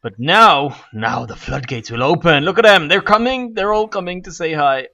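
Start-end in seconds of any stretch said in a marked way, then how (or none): 2.4-4.9 giddy, high and rising excitement; excited. Real, not acted. Gamer watching his zombies pour in, excited. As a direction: burst out as they appear. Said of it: "I'm so excited".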